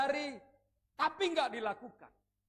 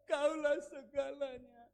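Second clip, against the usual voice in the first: sobbing mock devotion; mock crying prayer; 4 semitones higher, much slower than usual. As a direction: ham up a weepy prayer to God. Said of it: "Bahkan ketika engkau menangis".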